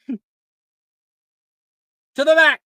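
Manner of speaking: high, loud order; yell. Acted. Professor ordering the student back, yell. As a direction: yell them to the back of the line. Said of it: "she yells".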